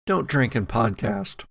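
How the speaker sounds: normal talking voice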